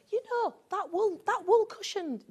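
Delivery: high, loud excited customer voice; imitating customers. Presenter acting out customers praising a wool cushion, high and loud. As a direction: mimic a customer gushing over a cushion. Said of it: "people will go".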